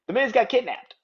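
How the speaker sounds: real giggle breaking into the words; laughing. Real, not acted. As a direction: tell it through real laughter. Cracks up announcing the news.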